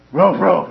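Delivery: loud mock dog barks; imitated dog bark. Imitating the dog that surprises him.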